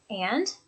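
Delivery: "and" lifting at the end; questioning rise on "and"; rising intonation. Shown as a demonstration. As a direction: say "and" as an upturned prompt to continue. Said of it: "with that rising intonation".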